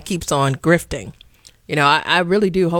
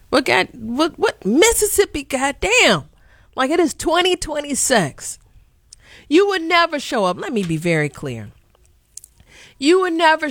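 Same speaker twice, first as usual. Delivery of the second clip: indignant outburst; 10 semitones higher than usual. Real, not acted. Outraged rant at officials.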